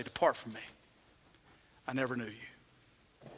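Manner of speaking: soft solemn final judgment; hushed verdict. Retold or imitated. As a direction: let the sentence fall almost silent. The Master's verdict, told sadly.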